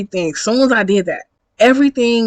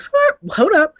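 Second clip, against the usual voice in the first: high-pitched mock screech to a stop; incredulous, high "hold up"; startled halt; 10 semitones higher than usual. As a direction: slam the brakes on them, pitched up. Acted reaction to cuddle request.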